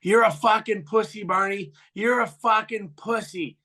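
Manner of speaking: high, insistent jeering yell; imitating a taunting yell; retold yell. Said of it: "and he yells".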